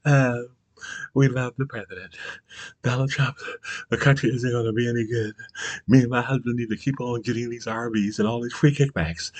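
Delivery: lightly voiced smug wife; mocking a smug wife. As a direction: sneer it out in her sweet put-on voice. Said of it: "Out there spreading that shit".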